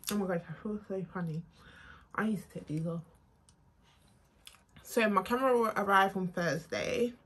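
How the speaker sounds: ordinary delivery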